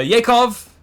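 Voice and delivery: put-on slow, loud Russian-accent call of "Yakov". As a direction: bellow the name like a Russian toast. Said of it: "say that in a Russian accent".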